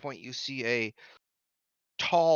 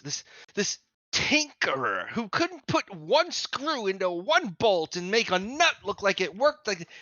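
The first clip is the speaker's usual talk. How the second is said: scornful outburst; 5 semitones higher than usual. Acted. Sage scorns Copper the tinkerer.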